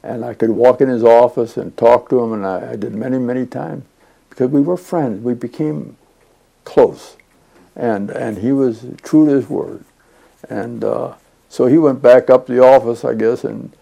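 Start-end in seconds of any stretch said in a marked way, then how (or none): none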